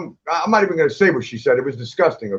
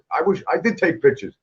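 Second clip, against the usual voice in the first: ordinary talk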